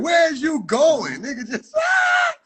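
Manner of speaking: exasperated yelp at the game; frustrated yell. Real, not acted. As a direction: let out a squealing yell. Frustrated at the running character.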